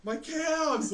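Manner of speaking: high, loud cry of pain; cramping swimmer calls to the coach, loud and pained. Retold or imitated. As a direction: wail about the calves. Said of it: "I'm like".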